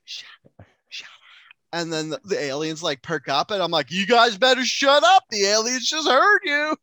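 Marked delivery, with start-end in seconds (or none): 0.0-1.1 urgent hushed whisper; whispered warning to stay quiet; whispered shut up. Pretend. Hushing in imagined alien scene. 3.9-6.8 higher, louder panicked warning; high loud mock panic; mock alarmed warning. Warning friends in imagined scene.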